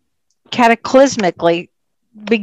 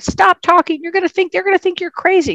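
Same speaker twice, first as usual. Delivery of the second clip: high, urgent mock telling-off; hushing a sibling in a raised pitch; imitated scolding sister; 10 semitones higher than usual. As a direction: act out an alarmed sister trying to shut someone up. Acted sister scolding her to stop.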